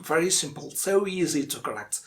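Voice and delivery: ordinary talk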